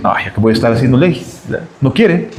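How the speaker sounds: low, quick, dismissive grumble; voice of pride. Acted. Preacher voicing pride that gives up, dismissive grumble. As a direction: grumble it off as wounded pride would. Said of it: "El orgullo, ¿qué te dice?".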